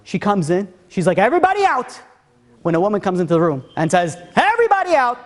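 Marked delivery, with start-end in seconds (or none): 1.2-2.0 high-pitched, imperious call; sharp dramatic command, pitched way up; commanding order. Retold or imitated. Mother clears the meeting room. 4.3-5.2 very high-pitched, imperious call; commanding order. Retold or imitated. Woman ordering the whole room out.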